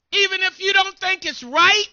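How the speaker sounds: ordinary talk, nothing marked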